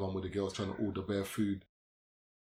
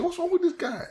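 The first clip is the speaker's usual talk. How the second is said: much higher, mock bewildered; baffled inner voice; 6 dB louder than usual. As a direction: squeak it out in disbelief. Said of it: "I was thinking".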